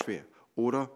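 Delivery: ordinary talk, nothing marked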